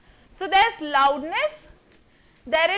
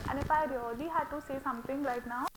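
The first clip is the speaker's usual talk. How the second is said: much softer, held low; quiet voice demo; 3 semitones lower, 11 dB quieter than usual. Shown as a demonstration. Teacher still demonstrating soft volume to students. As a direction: keep it hushed to prove the point. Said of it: "speak with everyone like this".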